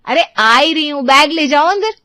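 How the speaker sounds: loud and high, ordering the bag in; calling out. Acted. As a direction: call out to her brother from the door. Ranu arrives, calls to brother.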